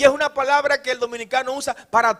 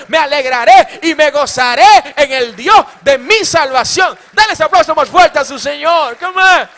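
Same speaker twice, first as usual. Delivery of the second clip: loud, high, shouted praise; full-force preaching; 5 semitones higher, 13 dB louder than usual. Acted. Preacher whips the congregation into loud joyful praise. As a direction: preach it out to the rafters with joy.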